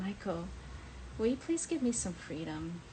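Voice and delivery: normal talking voice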